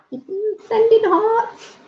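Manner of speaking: very high, long-drawn singsong; high, stretched-out sweet coo; affectionate singsong. Real, not acted. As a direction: coo it slowly to your friends, way up high. Sending love to chat viewers.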